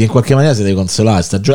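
ordinary talk, nothing marked

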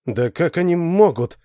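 high-pitched, helpless protest. A narrator's reading. Alan baffled by the pursuit, helpless protest. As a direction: start protesting, at a loss. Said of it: "беспомощно начал Алан".

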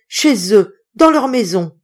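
loud, insistent groan of dread. A narrator's reading. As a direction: force it out in terror. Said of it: "râla le docteur".

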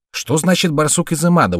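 high-pitched puzzled question; surprised. A narrator's reading. Meitei turns to the host for an explanation, surprised. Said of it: "удивлённо спросил Мэйтэй".

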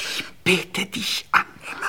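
langsam, tonlos geflüstert. Acted. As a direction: haucht ihr die Anbetung zu. Liebesgeständnis im Dunkeln. (inniges Flüstern)

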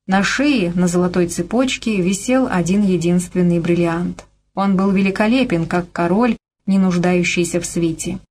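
ordinary delivery

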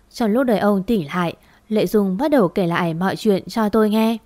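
ordinary delivery, nothing marked

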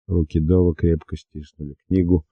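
plain reading voice

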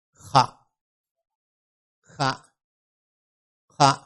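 "khạ" as a short syllable from the front of the throat; front-of-throat sound. Shown as a demonstration. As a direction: model "khạ" slowly, one at a time. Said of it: "khi đọc khạ đó là phụ âm kép mà trước họng". (đọc mẫu "khạ", âm trước họng)

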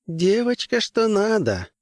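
high, sly leering voice; appreciative leer; leering crewman. Acted. Hollister ogling girl, high voice.

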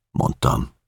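ordinary delivery

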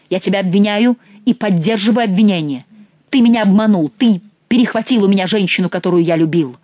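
loud, angry accusation; enraged duke accuses his master of the hunt loudly; furious accusation. A narrator's reading. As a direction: hurl the charge at him.

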